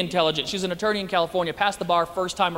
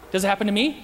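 usual speaking voice

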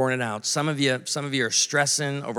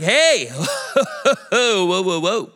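higher, laughing "whoa"; startled protest; 3 semitones higher, much slower than usual. Retold or imitated. Diner mistaken for staff stopping questions, mock alarmed. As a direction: halt someone with mock alarm.

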